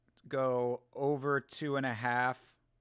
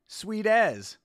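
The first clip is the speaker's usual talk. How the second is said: put-on New Zealand accent, louder, bright rise; Kiwi accent; 5 semitones higher, 5 dB louder than usual. Trying Kiwi slang in a New Zealand accent. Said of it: "speak with a New Zealand accent".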